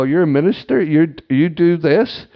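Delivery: can't believe what you just heard; surprised. Retold or imitated. Incredulous reaction to driver's job.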